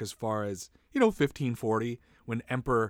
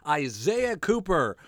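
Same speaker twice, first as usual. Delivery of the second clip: booming MC intro, higher pitch; announcer voice; 4 semitones higher than usual. Pretend. Club host introducing himself to the crowd, announcer voice. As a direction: hype up the comedian like a club host. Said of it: "like I'm doing stand-up now".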